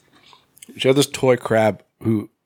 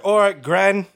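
higher-pitched mock grandkid voice; imitating grandkids; 10 semitones higher, 3 dB louder, slower than usual. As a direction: play an indulgent grandkid, pitched up. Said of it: "They'll be like".

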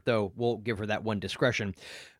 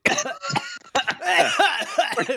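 laughing while coughing hard; sputtering coughs with laughter; coughing fit; 3 dB louder than usual. Real, not acted. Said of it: "I'm choking on tea".

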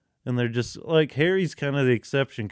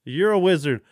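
raised, louder wizard reveal; mock announcement; 5 semitones higher, 5 dB louder than usual. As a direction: proclaim it like big news.

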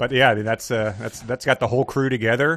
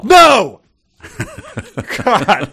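much higher, much louder cry of disbelief; shocked shout; 12 semitones higher, 13 dB louder than usual. Real, not acted. Co-host shouts on hearing the answer was real. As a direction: yell no in disbelief.

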